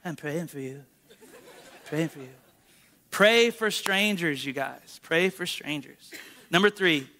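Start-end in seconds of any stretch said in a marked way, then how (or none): 0.0-2.3 much softer, mumbled with a laugh; mock the shy congregation, barely audible; sheepish mumble set against a baseball crowd's screaming; timid church murmur. Shown as a demonstration. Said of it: "In here, you just say".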